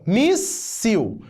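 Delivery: "míssil" slowly broken in two, "mís... sil"; slow teacher's syllable split on "míssil". Shown as a demonstration. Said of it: "Primeira sílaba, mi, segunda, sil".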